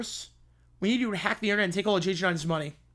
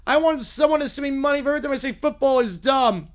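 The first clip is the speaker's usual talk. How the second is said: ordinary talk, nothing marked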